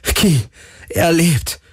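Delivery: very high, elated exclamations; jubilant. A narrator's reading. Galen tells Ki the spirit is alive, jubilant cry. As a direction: cheer it out, overjoyed. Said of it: "jubelte Galen".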